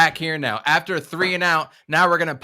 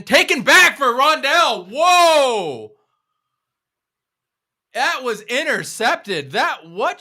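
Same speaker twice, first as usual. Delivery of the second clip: high, loud stunned outburst; can't believe what he saw; shocked; 11 semitones higher, 7 dB louder, slower than usual. Real, not acted. Shock at interception.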